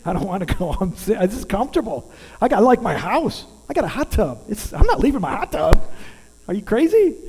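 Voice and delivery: loud, high mock complaint to God; fifty and not leaving the hot tub, loud mock protest; indignant protest. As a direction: reenact the stubborn refusal with a laugh in it. His protest to God retold.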